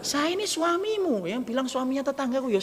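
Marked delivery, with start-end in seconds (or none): 0.1-1.3 higher and slow, mock indignant; hammed-up wounded pride; offended husband. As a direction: puff up like an insulted husband. Offended husband asserting status.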